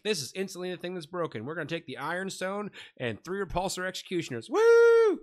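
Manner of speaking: hyped-up gamer cheering; mock hype over a broken army; excited. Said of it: "where we all were like".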